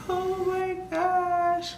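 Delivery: high, very slow, soft and amazed; awed delight. Retold or imitated. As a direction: melt at first sight of the son. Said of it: "I'm like".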